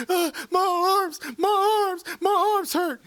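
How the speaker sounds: put-on high, slow, whiny kid crying over sore arms; mock crying whine. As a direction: whimper "my arms" like a little kid in tears. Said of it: "just start crying".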